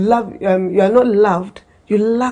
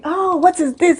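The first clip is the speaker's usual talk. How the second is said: put-on curious child; 8 semitones higher than usual. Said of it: "The child will now be".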